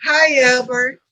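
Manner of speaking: high, overly nice and gentle; mock a too-polite request; sweet polite voice. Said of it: "Not like".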